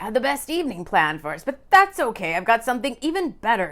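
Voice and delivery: perking up with a new plan. A narrator's reading. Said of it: "sounding more excited now".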